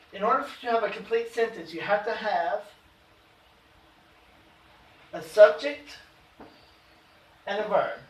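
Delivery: usual speaking voice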